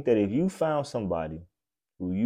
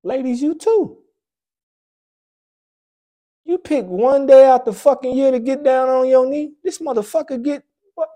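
high preachy rant; 11 semitones higher, 6 dB louder than usual. Real, not acted. Preaching to couples.

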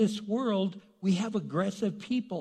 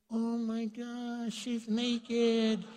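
higher, slow, shocked gasp of a tempted man; flustered shock; 5 semitones higher, much slower than usual. Retold or imitated. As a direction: act startled and flustered, drawn out. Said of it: "He's like".

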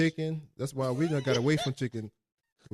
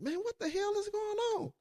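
high-pitched mock bewilderment; baffled disbelief; 7 dB quieter than usual. Man retelling his reaction to her confession, baffled. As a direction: exclaim it high, as if appalled.

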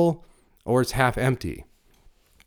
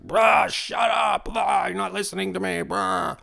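high, sudden shout at the sons, then a strained high push; blowing up at the kids in the car; angry outburst; 7 semitones higher than usual. Retold or imitated. Father losing his temper at his sons in the car, shouting. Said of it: "I just blew up".

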